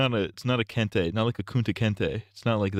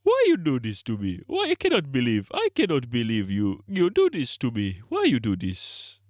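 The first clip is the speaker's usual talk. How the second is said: mock Nigerian accent, start high then go low; 2 semitones higher than usual. Shown as a demonstration. Showing the mock Nigerian accent pattern, high to low. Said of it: "they always start high and then they go low".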